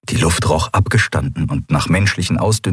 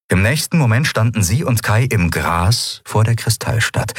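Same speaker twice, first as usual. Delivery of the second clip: usual speaking voice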